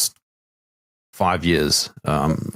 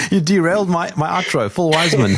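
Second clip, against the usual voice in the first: laughing; 8 semitones higher than usual. Real, not acted. Laughing after the joke.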